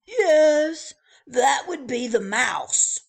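loud, high, cheery bellhop welcome; chirpy old-fashioned bellhop. Acted. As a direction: call out the welcome like a grand hotel porter. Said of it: "said the bellhop".

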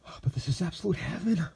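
high, rapturous exclamation; exclaiming. A narrator's reading. Lexington exclaiming over the smell of dinner, rapturous and high. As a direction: gush it out in delight. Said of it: "he cri-, he exclaimed".

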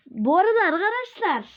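1 bright call. Real, not acted. Calling out to viewers.

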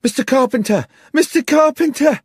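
high, loud cry of alarm; screaming young woman. A narrator's reading. Distraught young woman bursts into the bar calling the landlord, screaming. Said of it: "came screaming into the bar".

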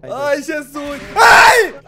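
startled scream; sudden frightened yell; scared horror game streamer. Real, not acted.

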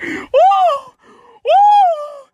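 high, loud whooping; celebratory shout. Real, not acted. Gamer celebrating his first kill with a loud whoop. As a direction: whoop at full volume.

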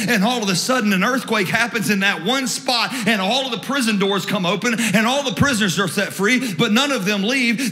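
high, driving sermon storytelling; impassioned preaching. Real, not acted. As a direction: preach the miracle with mounting excitement. Preaches story at full force.

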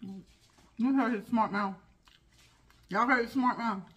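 normal talking voice

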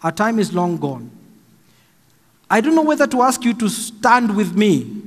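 ordinary talk

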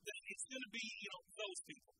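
loud, driving sermon delivery; full-force preaching. Acted. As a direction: preach it hard to the crowd. Preaching to congregation.

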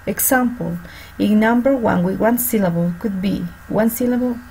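ordinary talk

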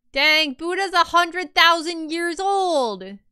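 amazed. Real, not acted. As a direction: stretch it out in disbelief. Amazed exclamation at fact.

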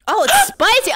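rising alarm at the heat; surprised. Real, not acted. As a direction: realize it burns. Realizes soup is spicy.

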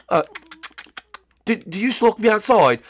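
ordinary talk

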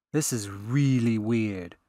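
slow, uneasy grumble; grumbling. Acted. Reen eyeing the old pulsar gun, slow grumble. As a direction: drag it out, unsettled. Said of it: "Reen grumbled".

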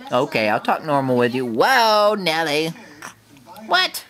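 ordinary talk, nothing marked

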